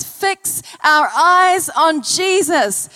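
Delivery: drawn-out, emphatic; slow scripture quote. Acted. Preacher to church, quoting the Bible slowly. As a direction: stretch it out as you quote scripture.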